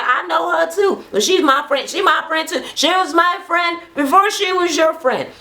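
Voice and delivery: high-pitched, insistent bickering; mock quarrel, voice pushed high; squabbling one-upmanship. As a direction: imitate a petty argument heating up. Acting out an escalating friendship squabble.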